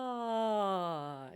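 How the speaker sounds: open-throated yawn sighed out. Shown as a demonstration. Vocal coach showing a yawn sigh to warm up. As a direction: let the sigh fall out through a big yawn. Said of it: "let's do a yawn".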